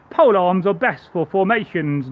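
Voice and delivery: ordinary delivery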